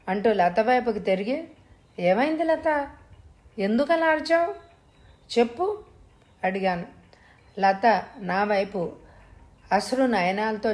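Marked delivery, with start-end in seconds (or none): 2.0-5.8 high, worried pleading for an answer; concerned husband, much higher in pitch; anxious questioning. A narrator's reading. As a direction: press your wife to explain, voice pitched up. Husband presses frightened wife after scream.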